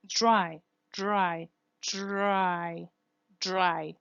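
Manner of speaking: "dry" with a buzzed, frictional R after D; "dry" with DR close to a J sound; fricative R. Shown as a demonstration. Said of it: "this type of R occurs".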